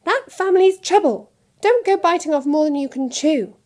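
high, loud wifely scolding; sharp. A narrator's reading. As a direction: lay down a stern warning. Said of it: "said Missus Weasley sharply".

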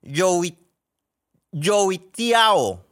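"yoitiao" attempted in Chinese, high and loud; attempted Chinese pronunciation. Shown as a demonstration. Podcaster reading out the Chinese name of churros, attempted pronunciation. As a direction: sound out "yoitiao" carefully as a foreign word. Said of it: "con la pronunciación nunca voy a poder".

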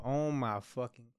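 ordinary talk, nothing marked

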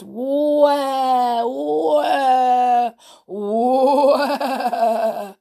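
long, high, wavering echo calls; thundering echoes. A narrator's reading. Echoes answer George's shout, thundering eerily. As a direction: let each word ring out and quaver. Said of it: "the echoes thundered out all around".

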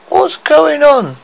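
slow, high, drawn-out question; groggy, lightly acted bewilderment; a bit blurry. Poisoned girl waking among dwarfs, blurry. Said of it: "a bit blurry".

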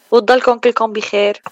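ordinary delivery

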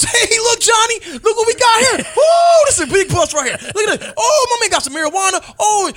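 high, loud gleeful shouting; gloating cop. Retold or imitated. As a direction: crow over the find like a cocky rookie. Cop gloating over found drugs.

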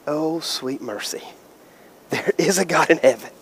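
almost prayerful relief; hushed relief. Real, not acted. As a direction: breathe it out in thankful relief. Relief after blocked kick.

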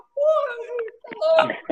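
high, sharp cry of disbelief, half laughing; indignant outburst. Retold or imitated. Retelling a friend refusing free pizza, indignant. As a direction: blurt it out, outraged and amused at once. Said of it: "meio indignado".

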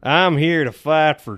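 loud, slow Southern US drawl; Southern US accent. Acted. Player quotes Superman in a loud, slow Southern US accent. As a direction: drawl it big like a Southern American. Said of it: "Just Southern U S".

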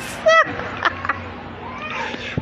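a very high, breathless squeal of a laugh; shrieking laugh. Real, not acted. Cracking up at the scene.

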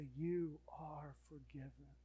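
slow, whispered forgiveness; whispered assurance of forgiveness; tender whisper. Acted. God's tender words.

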